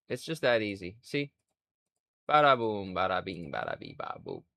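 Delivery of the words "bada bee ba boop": ordinary talk, nothing marked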